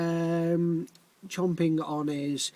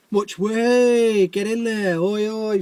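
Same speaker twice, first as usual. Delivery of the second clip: shouted, higher and louder lads' cheer; rowdy builders cheering; 4 semitones higher, 6 dB louder, slower than usual. Acted. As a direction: whoop it up like builders in a van.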